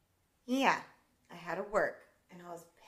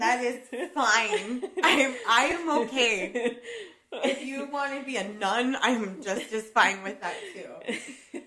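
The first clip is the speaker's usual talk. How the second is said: slow, laughing and a bit louder; half-laughing, drawn-out mock comfort; laughing reassurance; 3 semitones higher, 5 dB louder, slower than usual. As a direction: reassure her through the giggles, drawn out.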